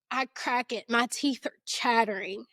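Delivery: ordinary delivery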